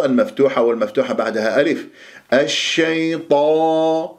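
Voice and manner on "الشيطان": الشيطان with a long, open emphatic "taa"; very slow chanted الشيطان, open long vowel; heavy open ta. Shown as a demonstration. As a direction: hold the ط of الشيطان full and unrounded. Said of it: "وليس طو".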